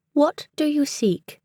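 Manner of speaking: high, slow, crisp British voice; crisp British accent. A narrator's reading. Enchanted book addressing its seekers, crisp British accent. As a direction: ask it formally in crisp British English. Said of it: "they said in a crisp British accent".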